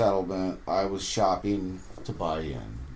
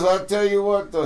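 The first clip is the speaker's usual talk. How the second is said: Corey's voice; 11 semitones higher, 6 dB louder than usual. Retold or imitated. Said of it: "He goes".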